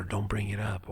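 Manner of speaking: whispered, the words barely breathed; hushed whisper. Retold or imitated. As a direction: whisper it as if the subject were forbidden. Quoting hushed advice about grief.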